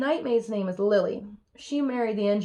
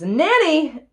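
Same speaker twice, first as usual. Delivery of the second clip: loud, raised call for help; acted tutor's scream; 7 semitones higher, 7 dB louder than usual. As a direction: holler for the nanny, higher and louder. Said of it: "And then he screams".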